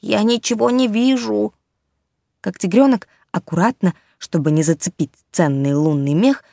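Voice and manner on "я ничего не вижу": muffled voice. Acted. Bear muffled inside plastic suit.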